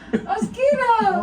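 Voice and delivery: laughing squeal. Real, not acted. Laughing through the plank.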